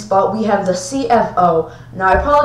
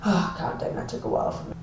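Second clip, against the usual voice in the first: whispering; 12 dB quieter than usual. Real, not acted. Exhausted aside off mic.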